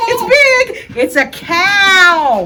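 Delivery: put-on big, bright, loud voice for kids; overbright kids party host. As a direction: sell the big reveal to little kids. Host hypes the cow reveal.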